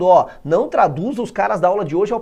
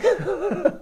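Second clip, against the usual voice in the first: laughing; 12 semitones higher than usual. Real, not acted. Laughs after scolding.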